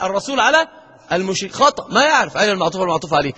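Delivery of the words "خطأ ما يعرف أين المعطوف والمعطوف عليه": ordinary talk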